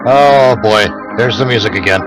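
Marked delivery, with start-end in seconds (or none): none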